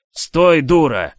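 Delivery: loud, high angry yell; shout. Acted. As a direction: bark it across the barn. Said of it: "раздалось сзади".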